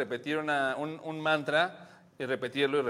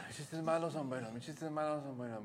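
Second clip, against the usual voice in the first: each repetition softer and softer; fading mantra; 6 dB quieter than usual. Shown as a demonstration. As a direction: repeat it like a mantra, fading into sleep. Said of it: "te vas a repetir a ti mismo".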